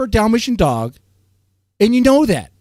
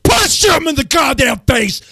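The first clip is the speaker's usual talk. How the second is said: shouted and high, mock rage; imitated outburst; 7 semitones higher than usual. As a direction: bark it like you've lost it. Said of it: "I'll be like".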